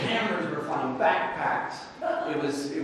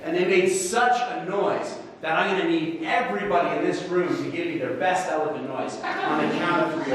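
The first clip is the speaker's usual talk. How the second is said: ordinary talk, nothing marked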